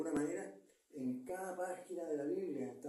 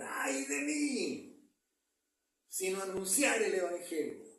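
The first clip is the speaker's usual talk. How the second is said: raised, anguished scripture cry; preacher's cry; 3 semitones higher, 4 dB louder, slower than usual. Shown as a demonstration. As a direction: cry out the verse, drawn-out high "¡Ay!". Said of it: "Y ahí viene un grito".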